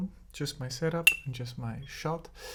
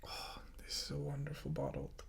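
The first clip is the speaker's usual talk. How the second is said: whisper; 11 semitones lower, 13 dB quieter than usual. Real, not acted. Admiring the whiskey bottle.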